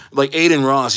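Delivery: ordinary delivery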